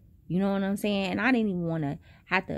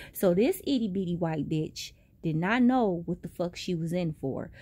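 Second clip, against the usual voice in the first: normal talking voice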